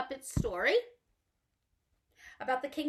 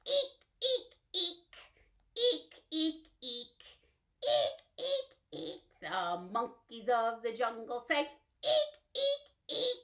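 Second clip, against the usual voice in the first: monkey eek. Pretend. Teacher shrieking monkey calls for children. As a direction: screech like a playful monkey.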